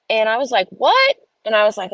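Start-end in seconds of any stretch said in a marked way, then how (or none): 0.7-1.2 very high, louder stunned "what"; shocked. Retold or imitated. Mom hearing about the teacher, stunned high disbelief. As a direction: jump up in pure disbelief.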